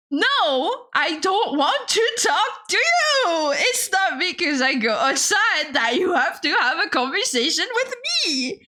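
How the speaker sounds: high, stretched-out protest; hammed-up, high-pitched refusal; exasperated whine. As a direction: wail it like a fed-up complaint. Acted whining rebuff to strangers.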